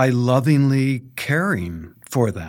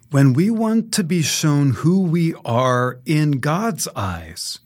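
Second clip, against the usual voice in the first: ordinary talk, nothing marked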